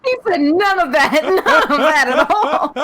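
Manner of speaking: high, laughing protest; cracked-up, high-pitched denial; real laughing. Real, not acted. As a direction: object through helpless laughter. Co-host cracks up at the story.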